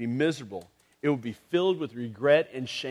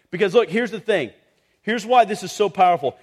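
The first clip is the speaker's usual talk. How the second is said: rising, louder preacher's build; preaching at full force; 6 semitones higher, 9 dB louder than usual. Acted. Pastor rousing the congregation, full-force preaching. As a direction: drive the point home to the crowd.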